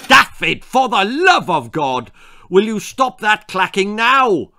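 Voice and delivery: loud, high desperate wail; wailing mother. A narrator's reading. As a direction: wail at him to stop. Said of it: "wailed his mother".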